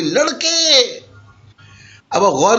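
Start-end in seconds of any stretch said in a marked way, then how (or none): 0.0-1.0 لڑکے called out high and rising, no اے before it; raised shrill call. Shown as a demonstration. Teacher demonstrating an informal call to a boy, high shrill shout. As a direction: shout لڑکے across the street. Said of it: "زور سے آواز لگائی".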